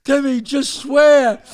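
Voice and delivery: high, loud, drawn-out yell; screaming plea. Retold or imitated. Stoned friend begs Timmy to swear, loud high yell. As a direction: shout your friend into giving in. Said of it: "They're all screaming this".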